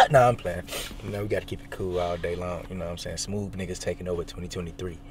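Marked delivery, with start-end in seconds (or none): none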